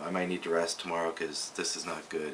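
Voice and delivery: usual speaking voice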